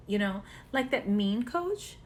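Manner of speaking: ordinary delivery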